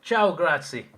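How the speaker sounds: hammed-up Italian accent, high and loud. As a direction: call it out in a mock Italian accent. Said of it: "Imagine David with Italian accent".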